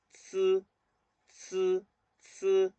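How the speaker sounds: "ts" as a long hissed affricate; tongue flat on the teeth, release each "ts" and hold; isolated "ts" syllables, drilled slowly apart; T-T-S sound. Shown as a demonstration. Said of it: "un sonido como T T S".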